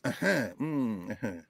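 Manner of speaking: soft, low agreeing hums; wordless affirmation. Shown as a demonstration. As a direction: murmur agreement slowly, almost without words. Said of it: "it's often verbal without words".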